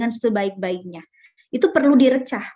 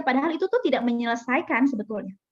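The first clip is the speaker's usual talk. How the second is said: ordinary talk, nothing marked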